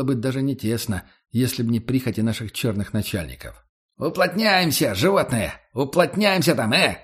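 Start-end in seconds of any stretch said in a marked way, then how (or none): 4.0-7.0 high, rough yell to pack in; shouting guard. Acted. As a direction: yell at the prisoners to squeeze together. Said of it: "орёт один из младших капа".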